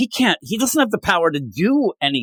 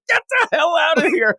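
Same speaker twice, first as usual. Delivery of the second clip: panicked shout. Retold or imitated. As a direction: scream it at the top of the range to flee. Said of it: "He's like".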